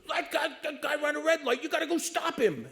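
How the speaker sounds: high-pitched excited urging; alarmed urging. Retold or imitated. Old chief urges officer to chase the red-light runner, high and excited. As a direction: get him, he ran the light!.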